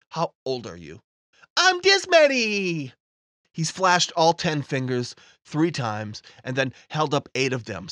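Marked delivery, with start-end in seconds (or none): 1.6-2.9 put-on high, slow proud toddler; a kid showing his age; little boy voice. Timmy answers age.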